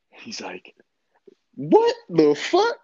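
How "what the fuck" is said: high, slow, drawn-out mock shock; stunned disbelief. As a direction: play the big cellmate floored by the answer. Cellmate stunned by tiny charge.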